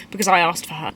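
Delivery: ordinary delivery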